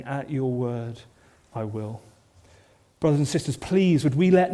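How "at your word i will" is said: hushed devotion. Acted. Congregation's response to Jesus' call.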